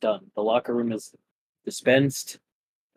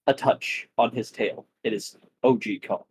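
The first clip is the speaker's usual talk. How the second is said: usual speaking voice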